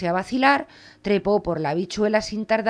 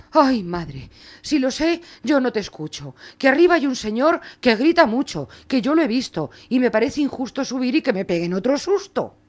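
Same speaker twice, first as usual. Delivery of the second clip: put-on frightened boy; 5 semitones higher, 5 dB louder than usual. Juan frightened after giant.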